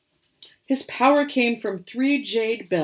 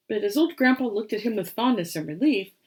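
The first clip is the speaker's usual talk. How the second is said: usual speaking voice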